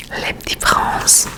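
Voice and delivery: Les Petits Princes whispered with French vowels and uvular r; French title. Shown as a demonstration. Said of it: "as per my French".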